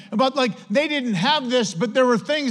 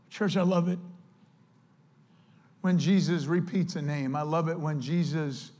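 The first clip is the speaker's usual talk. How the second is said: ordinary talk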